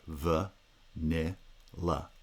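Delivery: "v, n, l" as separate "vuh, nih, luh"; syllable by syllable. Shown as a demonstration. Lecturer splits vanilla for students, syllable by syllable. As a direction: break "v, n, l" into short syllables with pauses. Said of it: "the syllables are".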